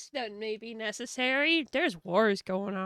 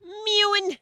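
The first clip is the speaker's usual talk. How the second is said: excited squeal; 10 semitones higher, 11 dB louder than usual. Acted. Excited outburst about catgirls.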